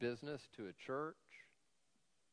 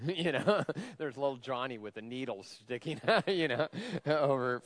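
laughing; 2 semitones higher, 6 dB louder than usual. Real, not acted. Joke about a needle in Johnny's eye.

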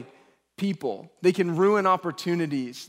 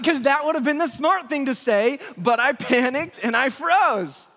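loud, high self-defence; mock-exasperated outburst, pitched up; exasperated retort; 6 semitones higher, 4 dB louder than usual. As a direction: protest your excuse, slowing at the end. Acted exasperated retort to wife.

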